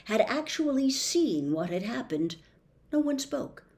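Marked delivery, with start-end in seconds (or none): none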